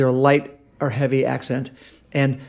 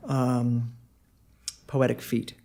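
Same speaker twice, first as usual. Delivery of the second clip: ordinary delivery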